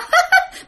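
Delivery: very high eerie cackle of a ghost woman; ghostly cackle. Acted. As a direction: let out a chilling ghost laugh. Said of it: "bà cười há há há há lên".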